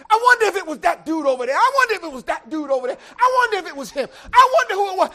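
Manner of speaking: fast, loud, high anxious rambling; frantic wondering. Acted. Joseph doubting Mary, shouting his racing thoughts high and fast. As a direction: spill it out in a rush of suspicion.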